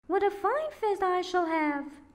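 high witch voice savouring the thought; gloating witch. A narrator's reading. Witch eyeing the sleeping children, high and gloating. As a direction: relish it like a hungry old witch. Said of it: "she said to herself".

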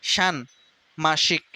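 ষান্মাসিক drawn out very slowly, syllable by syllable; slowed-down ষান্মাসিক for students; slow syllable drill. Shown as a demonstration. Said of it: "এখানে দুইটাই তালব্য স".

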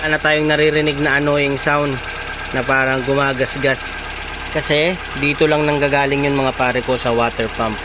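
talking over a running engine; engine running behind. Real, not acted. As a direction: speak over idling motor noise. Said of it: "narinig nyo yung makina natin".

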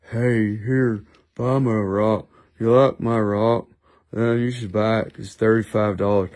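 low, dreary sales pitch; mock a seller with no personality; dull rival seller. Mocking other sellers pitching their rocks, low and lifeless. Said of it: "all them other sellers, they're just like".

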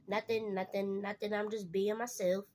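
usual speaking voice

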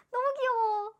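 high, loud burst of delight; overwhelmed fan gushing; cuteness squeal. Real, not acted. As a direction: squeal at how adorable it is. Sees adorable photo.

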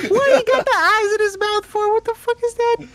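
high, startled disbelief; shocked squeal. Real, not acted. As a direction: shriek at the monster's mouth. Startled by monster.

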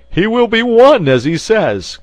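high, loud exclamation; crying out. A narrator's reading. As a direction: proclaim it at the top of the voice. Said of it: "cried Porthos".

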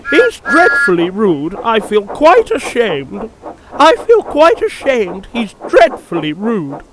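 high, loud fussy twitter. A narrator's reading. Two coaches fuss to each other, high twittering. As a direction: twitter in shocked indignation, pitched well up. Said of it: "they twittered to each other".

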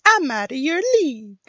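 high-pitched cartoon boast; put-on character voice. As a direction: pitch it way up for a game-character one-liner. Voice acting an Overwatch character line.

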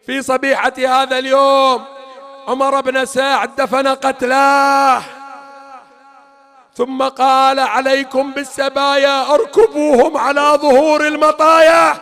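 loud, slow, driven declamation; fervent mourning sermon. Real, not acted. Preacher recounting Karbala to mourners, loud. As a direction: hammer the grief home.

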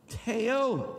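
Teo punched high and loud; stress on teo. Shown as a demonstration. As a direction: hit Teo hard on the o. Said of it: "Accent on the O".

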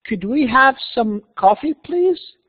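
slow, high request, rising sharply on "please"; polite. Shown as a demonstration. Manager asks an employee for some coffee, polite rising request. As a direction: ask courteously, lifting the last word. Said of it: "دائمًا يكون هو أسلوب المهذب".

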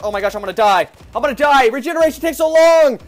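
rising panic as health drops; panicked yelling. Real, not acted. Gamer panics to viewers as he is about to die.

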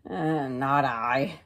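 low, slow, lazy grunt of a refusal; slow lazy pig. Acted. The pig turning down the hen, slowly. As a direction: drag out a lazy no.

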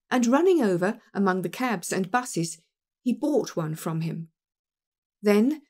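plain reading voice

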